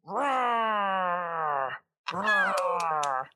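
playful little roar; Jasmine roar. Pretend. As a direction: give a cute, mock roar. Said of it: "Can you roar for me?".

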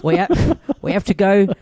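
a hint of a Cockney butler voice; lightly voiced English butler; Michael Caine impression. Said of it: "give us a little bit of Mickey C".

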